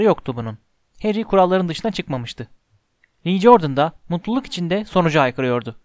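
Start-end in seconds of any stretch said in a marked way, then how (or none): none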